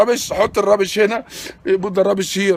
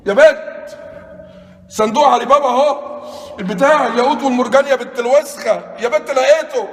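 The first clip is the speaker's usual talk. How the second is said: excited discovery; 6 semitones higher, 3 dB louder than usual. Real, not acted. Found the treasure chest.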